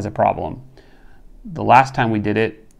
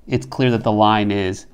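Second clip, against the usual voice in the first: usual speaking voice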